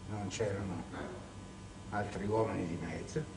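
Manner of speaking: "non c'erano" hushed and low, heavy with meaning; dropped to a hush. Shown as a demonstration. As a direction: sink "non c'erano" to a near murmur.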